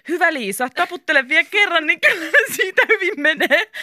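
high, silly teasing voice; hammed-up mock encouragement, near laughter; ridiculous voice. A narrator's reading. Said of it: "sanoi Mika naurettavalla äänellä". (naurettava, kimeä ääni)

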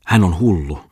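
startled exclamation; exclaiming. A narrator's reading. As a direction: cry it out while fleeing. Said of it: "ennätti toimittaja huudahtaa".

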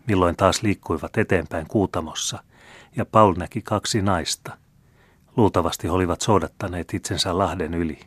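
ordinary delivery

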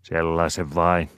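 plain reading voice

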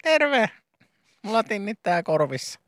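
high-pitched patient; silly patient voice. Acted. New patient greeting the nurse in a high silly voice. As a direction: play a squeaky new patient.

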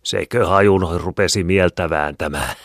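mocking old attendant; laughing caretaker woman. A narrator's reading. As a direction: tease them, amused and hearty. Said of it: "seisoi luona ja nauroi".

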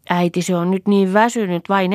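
put-on high child voice echoing a grown-up; child repeating an adult. A narrator's reading. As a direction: pass it on to mother as a small child. Child passes midwife's words to mother.

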